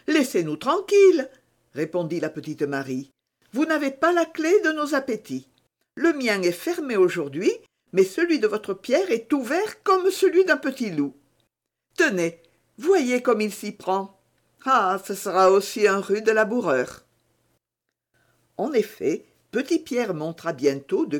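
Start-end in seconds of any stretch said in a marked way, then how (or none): 0.1-1.3 voix de jeune fille plus aiguë et plus forte; lance-le gaiement, en jeune fille qui taquine. A narrator's reading. Marie répond à Germain. 3.5-17.0 voix de jeune fille, plus aiguë, taquine; jeune fille enjouée. A narrator's reading. Marie parle à Germain.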